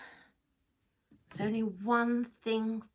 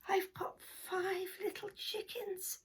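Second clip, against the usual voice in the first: proud whisper; 5 semitones higher, 7 dB quieter than usual. Acted.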